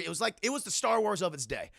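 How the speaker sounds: usual speaking voice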